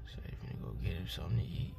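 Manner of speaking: low, hushed comment so he won't hear; hushed aside. Real, not acted. Quietly pointing out man, being filmed.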